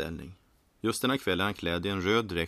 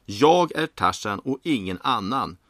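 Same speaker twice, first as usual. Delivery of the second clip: slow, emphatic shouted declaration; loud yell; 3 semitones higher, 8 dB louder, slower than usual. A narrator's reading. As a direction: shout it word by word, laying claim. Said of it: "skriker han högt".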